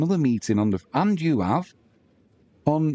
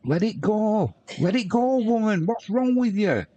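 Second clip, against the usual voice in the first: higher, teasing scold; mock exasperation; 11 semitones higher than usual. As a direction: tell her to drop it, half-joking. Teasing a chatter about bitterness.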